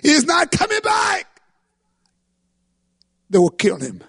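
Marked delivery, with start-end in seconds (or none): 0.0-1.4 high anguished cry, slowing at the end; breaking down. Retold or imitated. Wife crying out as the rebels undress her husband. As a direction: wail it out as hope collapses. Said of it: "she broke down and said".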